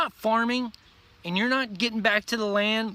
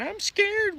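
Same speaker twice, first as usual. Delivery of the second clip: mock scared whine; 13 semitones higher than usual. Mocking scared America lovers.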